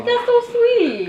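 high, slow, drawn-out coo; sweet cooing. Real, not acted. Host reacts to guest's sweet story, cooing.

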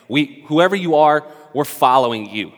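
usual speaking voice

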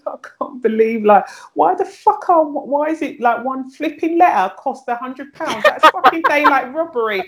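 high-pitched, half-joking outrage at the prices; mock outrage. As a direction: squeal it like a mock-indignant shopper, partly laughing at yourself. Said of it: "I would be like".

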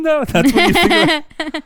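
high, loud laughing question. Real, not acted. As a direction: laugh through the disbelief. Laughing at friend's brain remark.

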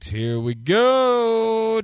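high, slow, stretched out here we go; drawn-out announcer. Pretend. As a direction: draw it out like a showman. Drawn-out lead-in before [name].

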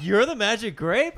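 high, loud, slow incredulous question; shocked disbelief. Retold or imitated. Kid learns his buddy is the Magic Grape, shocked squeal. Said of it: "And I was like".

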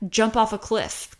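normal talking voice

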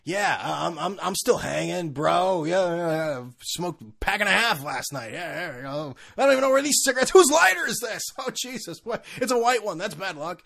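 lightly voiced hungover party bro; be the proud hungover bro. His younger self bragging after a night out, lightly voiced. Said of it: "But still, it was the fact that".

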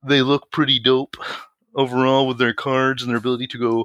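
lightly put-on smug nerd voice; sweaty neckbeard voice. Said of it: "sweaty neckbeard voice".

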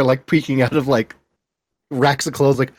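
usual speaking voice

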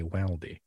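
Waldy with a short flat 'a', as in 'wall' turned to 'wal'; northern Waldy. Shown as a demonstration. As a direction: say Waldy the northern way, 'WAL-dee'. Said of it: "because I'm from the north".

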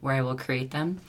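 normal talking voice

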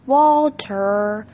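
drawn-out accusing call of the name; mock scolding. A narrator's reading. Uncle shifting blame onto the dog, mock scolding. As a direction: stretch out the dog's name in blame.

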